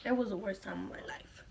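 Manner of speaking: soft, breathy whisper. Real, not acted. Telling viewers her worst moment, whispered. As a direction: drop to a whisper for the confession.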